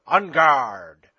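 shouted challenge; battle cry. Acted. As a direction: bellow it like a knight charging.

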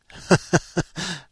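high, loud cackling laugh. Real, not acted. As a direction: burst out laughing. Amused by midget racing idea.

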